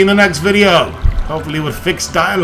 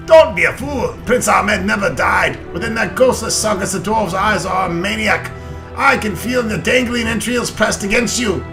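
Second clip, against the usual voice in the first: loud, higher hearty dwarf; 7 semitones higher, 4 dB louder than usual. Acted. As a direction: boom it out like an old dwarf. Reads dwarf lines in character.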